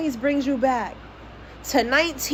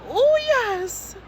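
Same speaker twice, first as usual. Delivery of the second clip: mock impressed ooh; 4 dB louder, much slower than usual. Mock admiring a rich lifestyle.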